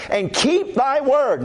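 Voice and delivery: slow, lifted recitation of the verse; slow reverent scripture recitation; solemn scripture reading. Acted. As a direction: intone it like holy writ. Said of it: "reading scripture slowly".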